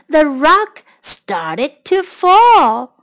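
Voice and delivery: plain reading voice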